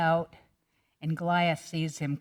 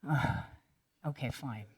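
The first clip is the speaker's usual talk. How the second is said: grudging sigh, dropping much softer; reluctant okay, fading to nothing; reluctant giving in; 4 semitones lower, 8 dB quieter than usual. Acted. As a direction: give in with a tired groan. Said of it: "Saul reluctantly said".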